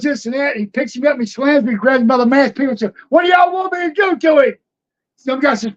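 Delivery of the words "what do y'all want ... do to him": a retold shout out to the crowd, pitched higher. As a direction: call it out to the whole arena.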